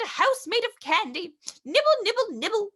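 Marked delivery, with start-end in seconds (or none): none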